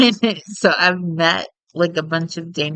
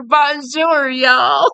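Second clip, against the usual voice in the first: put-on French greeting in a heavy Texas accent; high, loud, hammed-up Texan French; 9 semitones higher, 4 dB louder, much slower than usual. Teaching Ray fake French as a joke, hammed-up Texas accent. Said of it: "more with a really heavy Texas accent".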